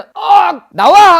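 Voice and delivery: high, very loud yelled command; mock exorcism shout. Shaman parodying exorcist to spirit, mock shouted command. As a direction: yell the spirit out, hammed-up.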